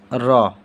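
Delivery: "र" as a short tapped r, falling; letter sound demo. Shown as a demonstration. Teacher says "र" with a light tapped r. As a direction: tap out the "र" for the class. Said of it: "इसका उच्चारण हो जाएगा".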